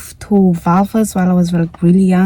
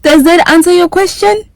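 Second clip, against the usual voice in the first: jumps way up, louder and teasing; high playful question; 7 semitones higher, 5 dB louder than usual. Real, not acted. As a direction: ask it high and cheeky, half laughing.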